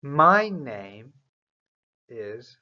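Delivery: "My name" lifted, then "is [name]" slowly; slow model answer. Shown as a demonstration.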